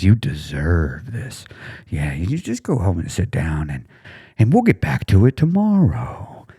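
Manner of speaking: slow, coaxing and indulgent; sweet slow temptation to quit; tempting inner voice. Retold or imitated. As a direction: lull them into giving up. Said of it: "So it tempts you".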